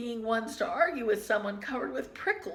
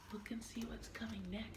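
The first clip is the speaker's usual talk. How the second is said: whisper; 16 dB quieter, much faster than usual. Real, not acted.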